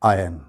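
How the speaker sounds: आयरन said "आयन", no r; silent r. Shown as a demonstration. A teacher gives students the correct form, r silent. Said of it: "सही pronunciation इस लफ्ज़ का क्या है".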